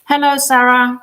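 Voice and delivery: ordinary talk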